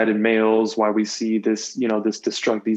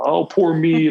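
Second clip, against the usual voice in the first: higher, louder mock self-pity; mock whiny crybaby; 6 semitones higher, 4 dB louder, slower than usual. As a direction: whine it like a crybaby, hammed-up. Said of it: "men to just be crybabies".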